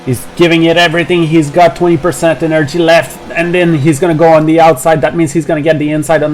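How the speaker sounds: rising live play-by-play; motorsport commentator. Real, not acted. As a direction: call the overtake as it happens.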